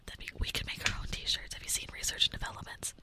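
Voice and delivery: soft whispered ASMR voice. Pretend. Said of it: "A S M R style".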